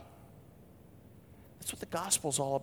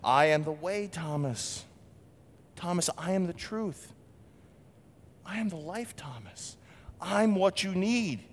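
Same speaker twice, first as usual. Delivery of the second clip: a hint of a loving teacher insisting; Jesus gently pleading with Thomas; tender Jesus; 6 semitones higher than usual. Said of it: "picture him saying it like this".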